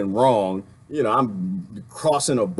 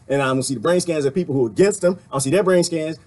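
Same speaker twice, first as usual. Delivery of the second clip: ordinary talk, nothing marked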